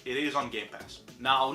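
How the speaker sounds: ordinary talk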